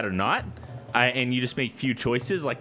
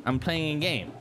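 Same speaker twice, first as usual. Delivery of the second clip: higher and softer, cooing to a cat; soft pet talk; 4 semitones higher, 4 dB quieter than usual. Real, not acted. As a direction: gently shoo a sleepy cat. Talking to his cat.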